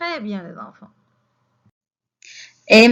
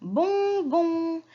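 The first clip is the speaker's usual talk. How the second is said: "bonbon" in two long, raised syllables; 6 semitones higher, much slower than usual. Shown as a demonstration. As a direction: stretch each "bon" slowly for young learners. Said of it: "Alors, bon".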